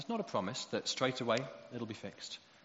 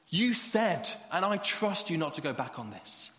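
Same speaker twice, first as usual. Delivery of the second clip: put-on insistent child; 5 semitones higher, 7 dB louder, slower than usual. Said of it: "holding my promise up in my face".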